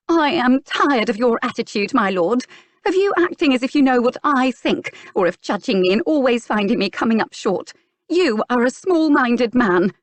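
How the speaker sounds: high, loud furious tirade; angry outburst. A narrator's reading. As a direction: finally let her temper out at him. Clarissa's angry outburst at earl.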